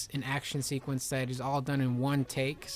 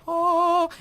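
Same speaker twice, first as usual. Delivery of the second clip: very high, loud yelp; hammed-up shout; mock exclamation; 8 dB louder than usual. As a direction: cry it out like a cartoon hero. Hero's exclamation in story.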